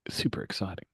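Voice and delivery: soft unvoiced whisper. Real, not acted. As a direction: drop to a whisper, teasing. GM teasing players' adventure.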